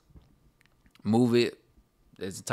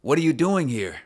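higher character read; 4 semitones higher, 3 dB louder than usual. Acted. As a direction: say it in a new character voice. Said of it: "That helps out so much".

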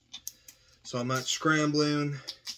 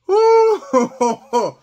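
loud whoop; 11 semitones higher, 12 dB louder than usual. Real, not acted. Spice rush.

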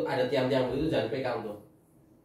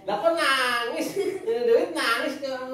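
teasing laugh; 11 semitones higher, 5 dB louder, slower than usual. Real, not acted. Teasing a crying child.

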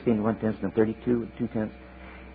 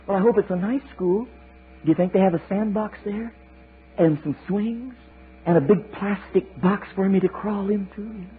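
slow, hopeful small eaglet; high, eager little child voice; 9 semitones higher than usual. Acted. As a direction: ask sweetly like a toddler. Eaglet hoping about school.

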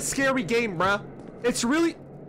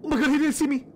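normal talking voice

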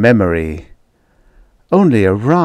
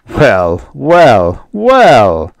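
amused father; 4 semitones higher, 6 dB louder, much slower than usual. Acted. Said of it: "But father laughed".

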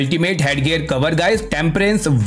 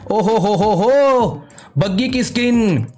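excited; 6 semitones higher, slower than usual. Real, not acted. Amazed at mythic buggy skin.

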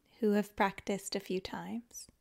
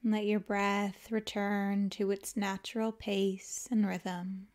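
calm, unhurried yoga nidra guidance with long pauses; guided meditation; 3 dB quieter than usual. Acted. Teacher guiding listeners to let the body melt, calm guided meditation. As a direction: ease them into rest, leaving space after each phrase.

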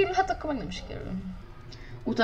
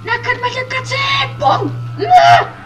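slow high words ending in a loud yell; rising shout; 10 dB louder, much slower than usual. Real, not acted. Racer about to crash shouts at the other car. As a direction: build up to a scream.

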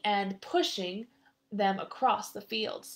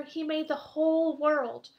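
emphatic; 6 semitones higher, 5 dB louder than usual. A narrator's reading. Reminding friend of God's power.

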